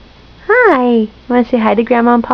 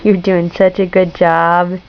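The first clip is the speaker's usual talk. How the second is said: tender praise; 7 semitones lower than usual. Real, not acted. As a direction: praise gently and slowly. Low slow praise.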